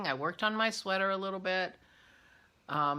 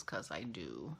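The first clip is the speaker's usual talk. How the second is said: ordinary talk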